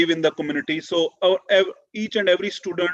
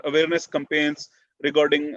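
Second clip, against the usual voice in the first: ordinary talk, nothing marked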